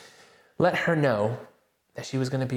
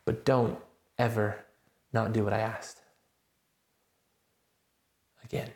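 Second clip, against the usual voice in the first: softer, calm voice of God; gentle reassurance; 2 semitones lower, 4 dB quieter than usual. Retold or imitated. God comforting Adrian after the missed chance, tender. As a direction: speak it tenderly, then firm on the warning. Voicing God's reply softly.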